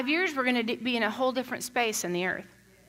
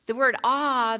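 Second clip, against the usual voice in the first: usual speaking voice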